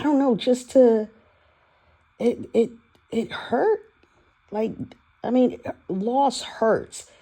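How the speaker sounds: high, halting voice fighting back tears; near tears. Real, not acted. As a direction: struggle to get the words out without crying. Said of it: "I'm not gonna cry".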